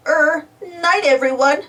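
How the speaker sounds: hesitant, drawn-out goodnight; a little confused. A narrator's reading. Muck answering goodnight from friends, slightly confused. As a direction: start uncertainly, mildly puzzled. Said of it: "sounding a little confused".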